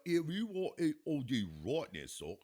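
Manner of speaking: put-on slow, stretched-out British accent. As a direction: put on British, drawing the words out. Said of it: "like, stretch 'em out".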